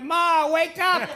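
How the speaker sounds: slow, loud, high mock wake-up call; hammed-up slow yell to wake Ma; old lady's daughter. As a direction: drag it out like rousing a sleeping old mother. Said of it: "and she went".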